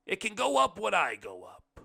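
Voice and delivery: guest refusing help, loudly. A narrator's reading. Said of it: "the blind man said loudly".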